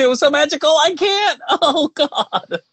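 high-pitched, emotional squeal breaking into a laugh; overwhelmed gushing. Real, not acted. Cohost overcome by a magical family moment, squealing and laughing. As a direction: gush it way up high, laughing as it gets too much.

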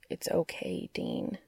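soft murmur. A narrator's reading. Cass holding Dean close, murmuring reassurance. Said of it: "he murmured".

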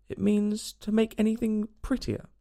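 slow, high, tentative explanation; doubtful. A narrator's reading. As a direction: feel the answer out hesitantly. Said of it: "said Alice doubtfully".

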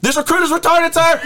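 very high, hammed-up recruit yell; mock recruit scream. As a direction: scream the reply at the instructor. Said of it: "And then I screamed".

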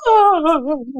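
a high, breathless mock-amazed whoa. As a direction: replay the moment he knelt, gasping out a whoa. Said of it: "and I was like".

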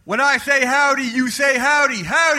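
rally the crowd with howdy; howdy call. Acted. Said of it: "do the howdy thing".

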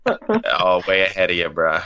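low, laid-back mock reply; imagined listener's reply. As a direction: lightly voice a knowing listener, pitched well down. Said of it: "you're just nodding and you're like".

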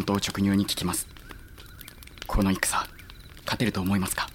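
soft, half-whispered ask; quiet confidential question; hushed question. Acted. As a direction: lean in and ask it low. Asking secretly at night.

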